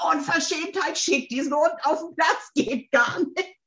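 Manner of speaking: high-pitched indignant rant, not shouted; mock raging inner devil. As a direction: play an outraged devil in your head. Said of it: "da wüteten zehn Teufel".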